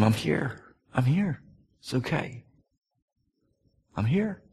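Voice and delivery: soft, gentle reassurance; tender comfort. Retold or imitated. Father holding his scared son close, softly reassuring. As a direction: hush him gently, I'm here.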